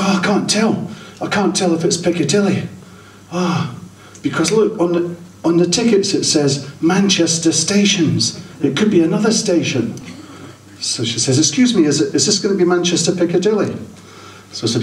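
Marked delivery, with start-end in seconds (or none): 0.0-9.9 lightly pitched-up fretful woman; a hint of a flustered passenger worrying; mock freaking out. Woman passenger fretting over the station, mildly panicked. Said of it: "The woman starts freaking out". 11.4-13.7 lightly voiced anxious woman. Woman passenger voiced high.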